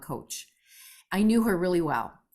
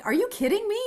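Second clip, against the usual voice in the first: high, louder incredulous cry; disbelief; 4 dB louder, faster than usual. Retold or imitated. Singer reacting to the [name] compliment, high and incredulous. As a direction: squeal it in happy disbelief. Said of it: "And I'm like".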